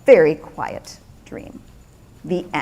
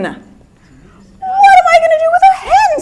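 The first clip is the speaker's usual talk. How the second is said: much higher, louder squeal of disbelief; baffled Peter; 6 dB louder than usual. Acted. Peter protests to the wise man, high and loud. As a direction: squeak out the question in outrage.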